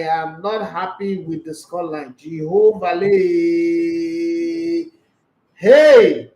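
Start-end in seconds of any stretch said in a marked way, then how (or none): 2.2-6.3 drawn-out groan then a loud burst; shocked outcry. Real, not acted. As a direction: cry out in disbelief at the score. Stunned outcry at a scoreline.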